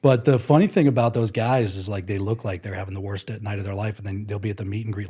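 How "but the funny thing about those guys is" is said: ordinary talk, nothing marked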